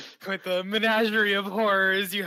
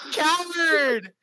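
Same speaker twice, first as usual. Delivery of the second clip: mock taunt; 8 semitones higher, 4 dB louder than usual. Mock insult at other player.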